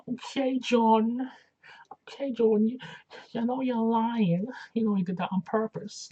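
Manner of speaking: high-pitched, drawn-out imitation southern accent; mock southern accent. Host imitates Alicia confronting her opponent, mock southern accent. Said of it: "doing sort of a whole southern accent".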